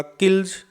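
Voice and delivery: "किल्स" ending in a voiced z/j after l; Z sound at the end. Shown as a demonstration. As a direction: say the last sound of "किल्स" as z, not s. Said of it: "इसलिए यहाँ इसका उच्चारण होगा". ("किल्स" के अंत में ज की आवाज़)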